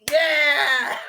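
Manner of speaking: loud gleeful yell; excited yell. Retold or imitated. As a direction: belt out a happy yeah. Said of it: "I'm like".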